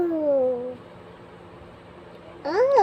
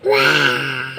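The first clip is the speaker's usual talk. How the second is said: put-on low growly child roar; toddler's little dinosaur roar; 5 dB louder than usual. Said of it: "What sound of dinosaur?".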